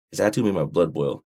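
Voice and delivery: ordinary delivery, nothing marked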